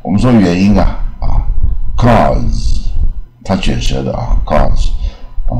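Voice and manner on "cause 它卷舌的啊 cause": "cause" with a long open vowel and final "z"; drawn-out, open "cause"; curled-tongue model. Shown as a demonstration.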